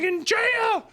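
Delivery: high mocking dad voice; hammed-up fed-up father. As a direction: squeak it like an exasperated dad. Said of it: "he's like".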